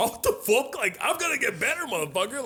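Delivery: high, outraged disbelief; indignant outrage. Retold or imitated. Teen to the MC in his head: high outraged disbelief. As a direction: squeal it like an offended fifteen-year-old. Teen's indignant inner reply.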